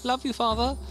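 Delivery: high sugary love you; mock tender son, pitched high; mock sweet farewell. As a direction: sing it like a doting son.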